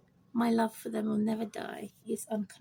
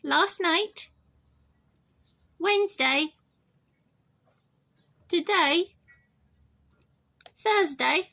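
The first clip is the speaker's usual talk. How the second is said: quizzing pet bird; 6 semitones higher, 4 dB louder, slower than usual. Pretend.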